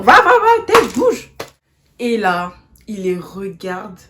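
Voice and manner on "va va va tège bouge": high, loud, shoving him off with her voice; mock shouting. Girl yelling at the boy to back off, high and loud. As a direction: shriek at him to get out of the way.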